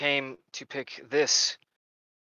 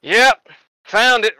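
higher, louder, slow triumphant find; drawn out, proud "found it"; pleased npc; 7 semitones higher, 7 dB louder, much slower than usual. Acted. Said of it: "goes like".

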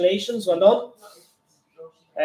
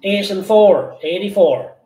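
loud sing-song bingo calls; bingo caller; 6 dB louder, slower than usual. Acted. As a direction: call the numbers like a bingo hall. Bingo number calling.

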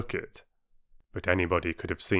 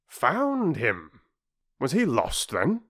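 a light voicing of the puzzled doctor; doctor asking while reaching for his coat; 6 semitones higher, slower than usual. Doctor voiced.

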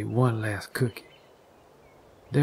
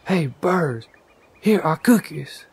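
higher, louder calling out; loud shout; 5 semitones higher, 5 dB louder than usual. A narrator's reading. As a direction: call the birds over from afar. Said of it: "He shouted in a loud voice".

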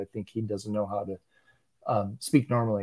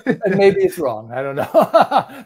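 drawn out through real laughter, pitched up; laughing; 5 semitones higher, 6 dB louder, slower than usual. Real, not acted. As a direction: laugh through a happy shrug of doubt. Laughs while doubting own answer.

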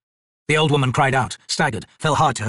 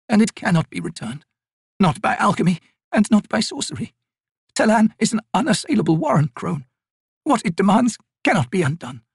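put-on weary, bitter old woman; 11 semitones higher than usual. Old Maibi refusing the raven's help, weary and bitter. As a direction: push away every hope, tired and bitter.

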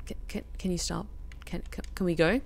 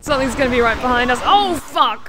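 panicked; 7 semitones higher, 8 dB louder than usual. Real, not acted. Panics as something chases.

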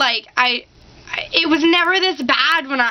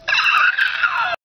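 high, wailing sob-laugh; crying laugh. Retold or imitated. Crying at The Croods, a wailing sob-laugh. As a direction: laugh through mock tears.

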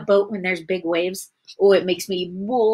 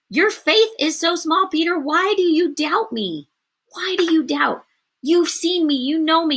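higher-pitched, insistent reproach; Jesus challenging Peter; 7 semitones higher than usual. Acted. Jesus to doubting Peter, acted insistent reproach. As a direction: press the doubter with pleading questions.

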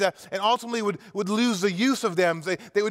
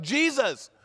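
crying out; 3 semitones higher, much slower than usual. Acted. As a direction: beg for mercy from a distance. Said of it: "crying out".

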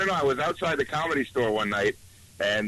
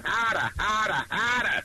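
put-on high, drawn-out Boston accent; mock Boston accent, pitched up; 7 semitones higher, slower than usual. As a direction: imitate a Boston girl, dropping the R's. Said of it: "I was with a girl from Boston".

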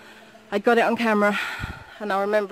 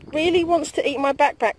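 ordinary talk, nothing marked

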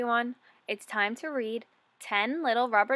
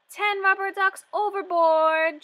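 higher, louder alarmed shout from the ship captain; captain's shout; 10 semitones higher, 6 dB louder than usual. Acted. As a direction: yell it out like a captain in a storm. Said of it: "shouts the captain".

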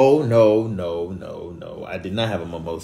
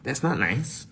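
mocking Siri; 6 semitones higher, 4 dB quieter, slower than usual. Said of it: "mocking Siri's words".

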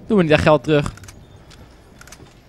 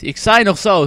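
ordinary talk

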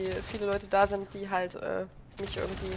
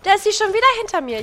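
high, fast, loud exasperated cry; exasperated outburst; 12 semitones higher, 10 dB louder, faster than usual. Real, not acted. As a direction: blurt it out, outraged and rushed. Startled, enemy behind him again.

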